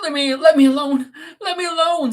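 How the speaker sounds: high, loud, tearful Catherine; sobbing plea. Acted. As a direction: beg to be left alone, distraught. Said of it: "sobbed Catherine".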